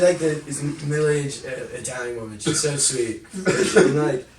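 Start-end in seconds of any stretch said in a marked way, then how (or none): none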